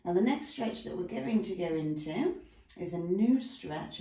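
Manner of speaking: normal talking voice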